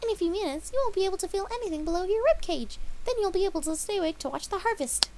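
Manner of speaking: high, loud cheery voice; Pinkie. A narrator's reading. Pinkie telling Dash about the harvest, high and loud. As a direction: explain it sweetly. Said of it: "she told her friend".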